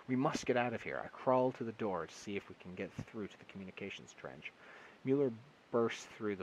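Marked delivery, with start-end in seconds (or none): 0.1-1.0 urgent shout. A narrator's reading. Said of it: "I shout at him".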